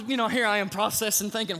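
ordinary talk, nothing marked

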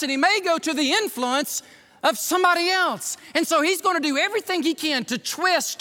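high, driving preacher's delivery; full-force preaching. Acted. Preacher warning church of a man losing control, impassioned. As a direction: press each phrase home, pitched high.

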